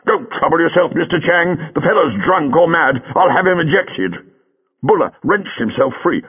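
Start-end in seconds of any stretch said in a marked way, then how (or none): 0.1-4.2 higher, blustering showman voice; theatre owner Jago. A narrator's reading. Jago to Chang, a lightly voiced blustering manager. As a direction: brush off the drunk with pomp.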